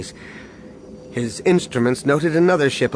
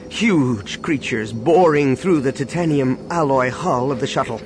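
ordinary delivery, nothing marked

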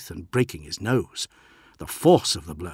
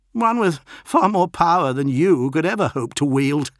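higher, louder boast; defiant postulant; 8 semitones higher, 7 dB louder than usual. A narrator's reading. Bloodied postulant provokes his captain, defiantly boastful. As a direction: boast of a greater master to provoke.

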